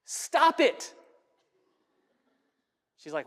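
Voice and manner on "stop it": higher, curt "Stop it"; curt dismissive order; blunt counselor command. Retold or imitated. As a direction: snap it off like an impatient counselor. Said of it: "he's playing the part of a counselor".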